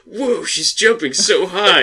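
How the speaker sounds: high, slow, wide-eyed gasp of awe; mock amazed bystander. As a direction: gawk up like a cheesy extra. Mocking reaction shots in a show.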